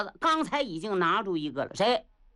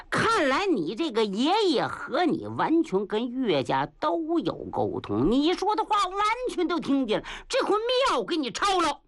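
gloating accusation; 8 semitones higher than usual. A narrator's reading. Hidden enemy exposes the spy.

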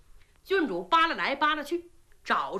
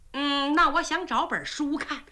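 ordinary delivery

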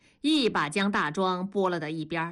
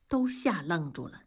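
ordinary delivery, nothing marked

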